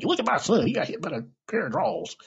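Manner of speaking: high, excited mom showing off her son; playful high-pitched motherly impression; imitated mother. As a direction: crow it like a proud, tactless mother. Mother bragging to strangers about son.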